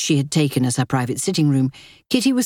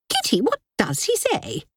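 high, snappish society lady; haughty sister-in-law; 12 semitones higher than usual. Acted. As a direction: press with sharp impatience. Linda presses Kitty again.

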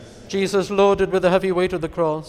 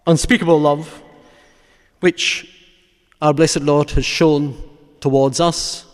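measured, fervent sermon close; solemn preaching; 6 dB louder than usual. Real, not acted. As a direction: preach it weightily, phrase by phrase, letting each one fall. Preaches a closing meditation on Christ's death.

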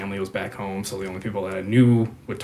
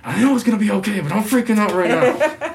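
mock panic laughing; 12 semitones higher, 8 dB louder than usual. His own freaking out, quoted.

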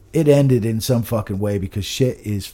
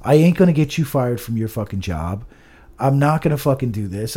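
ordinary talk

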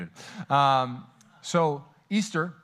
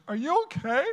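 wife asking; 11 semitones higher than usual. Retold or imitated. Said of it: "Rachel walks in and she goes".

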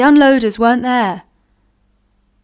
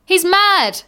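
mocking jeer; 8 semitones higher than usual. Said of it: "said one of the load carriers".

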